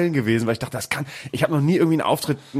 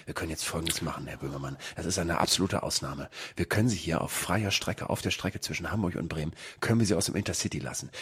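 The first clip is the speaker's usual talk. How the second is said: very soft, low secretive voice; hushed and deep, for one listener only; confidential murmur; 9 semitones lower, 11 dB quieter than usual. Retold or imitated. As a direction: imitate an official quietly offering a secret exception. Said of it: "raunt mir ins Ohr".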